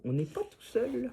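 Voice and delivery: high, soft, teasing secrecy; playfully coy. Real, not acted. Boyfriend refusing to name his love on camera, coy. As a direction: coyly dodge the question in a small voice. Coy about whom he loves.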